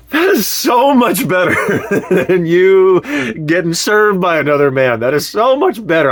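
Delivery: giddy with relief, laughing out loud. Retold or imitated. Husband laughing in relief at his wife's confession. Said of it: "And I was like".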